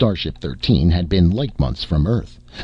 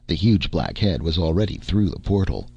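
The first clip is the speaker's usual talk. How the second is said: ordinary delivery, nothing marked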